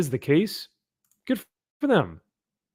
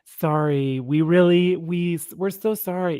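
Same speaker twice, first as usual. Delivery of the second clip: fake apology; 4 semitones higher than usual. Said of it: "it would actually be more like".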